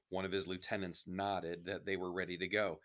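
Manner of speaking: ordinary delivery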